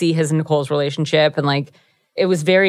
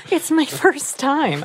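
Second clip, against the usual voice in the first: higher, slow, coy voice; mock shy voice; 9 semitones higher, slower than usual. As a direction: say it coyly, playing shy. Mock shy virgin line.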